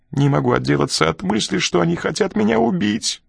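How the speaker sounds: high-pitched fearful moan. A narrator's reading. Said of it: "простонал он".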